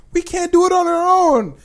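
high, hammed-up helpless plea; mock whiny high voice. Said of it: "they said".

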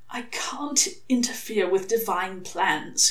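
hoarse croak on a whisper. Acted. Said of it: "he croaked".

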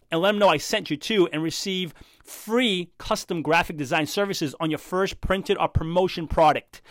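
smooth, polished podcast ad voice; sponsor ad read. Acted. Podcast host reads a sponsor message to listeners, announcer style.

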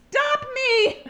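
high, loud plea; mock desperate shout. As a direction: shout for help, jokingly.

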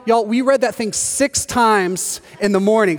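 ordinary talk

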